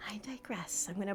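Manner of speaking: "I digress" in a whisper; whispered self-correction. Shown as a demonstration. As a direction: breathe it as a private aside.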